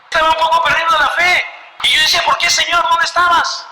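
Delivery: high, loud cry to God; anguished outcry. Retold or imitated. Man losing faith crying out to God, high and loud. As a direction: cry out where were you.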